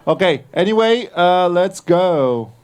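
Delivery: ordinary delivery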